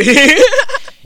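short, high, very loud laugh; loud guffaw. Real, not acted. Podcaster joking about their own show, loud high laugh. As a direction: burst out laughing at your own joke.

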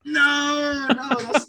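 high, drawn-out wailing no; loud protest. Real, not acted. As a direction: cry out in protest at the teasing. Protesting at the [name] joke.

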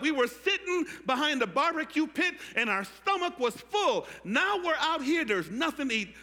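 high, loud comic complaining; grumbling Israelites. Retold or imitated. Preacher playing Israelites complaining to Moses, high and loud. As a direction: ham up the hungry whiner. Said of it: "Here's what they said".

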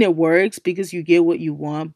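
normal talking voice

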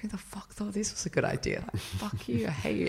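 hissed whisper, high and seething; mock-furious whispered swearing; silent cursing. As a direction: imitate cursing someone under your breath. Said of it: "like, cursing him so bad".

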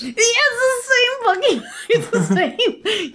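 high, slow, laughing through the words; laughing while protesting in disbelief; laughing exasperation. Real, not acted. Laughing frustration over pronunciation.

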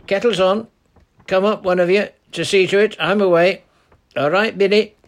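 loud, high call down through the trees; old man hollering to the men below; shout. Acted. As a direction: bellow it down the hill. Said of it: "he shouted in a much louder voice".

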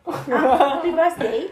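loud laughing birthday wish; excited birthday greeting. Real, not acted.

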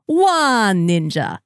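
slow, drawn-out vampire counting voice. Pretend. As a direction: count it out like a Sesame Street vampire. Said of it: "it inevitably turns into the Count".

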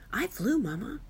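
put-on high, swooping baby gorilla voice; excited little child. A narrator's reading. Baby gorilla boasts to mother.

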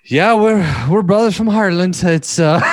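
high-pitched, hammed-up Irish accent; put-on Irish accent. Friend roped into posing as Irish brothers, put-on Irish accent. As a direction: badly bluff your way through an Irish accent. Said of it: "doing it with an Irish accent".

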